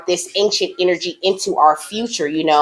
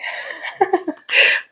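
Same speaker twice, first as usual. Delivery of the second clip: high airy laugh; 14 semitones higher than usual. Real, not acted. Laugh after message.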